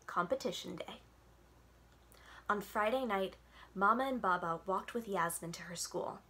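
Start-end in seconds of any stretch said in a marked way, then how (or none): none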